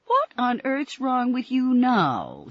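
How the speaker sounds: high, slow, accusing; accusingly. A narrator's reading. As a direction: point the finger with your voice. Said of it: "said Helen Mitchell accusingly".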